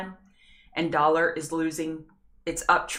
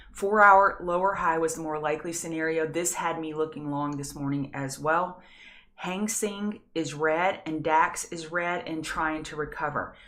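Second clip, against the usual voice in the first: normal talking voice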